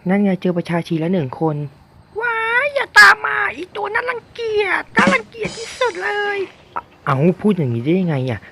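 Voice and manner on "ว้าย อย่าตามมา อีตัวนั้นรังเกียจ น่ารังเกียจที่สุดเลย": very high-pitched put-on villager shriek; ชาวบ้านโวยวาย. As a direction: squeal in disgust at the uncle, far above your own pitch. (เสียงดัดแหลมสูง ร้องวี้ดอย่างรังเกียจ)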